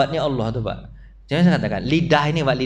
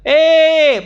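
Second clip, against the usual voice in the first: loud, high "Eh" called out; shouted call; 10 semitones higher, 7 dB louder than usual. Retold or imitated. Preacher imitating a neighbour calling out, loud shout. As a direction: call out "Eh" like hailing someone far off. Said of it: "padahal teriaknya sama-sama".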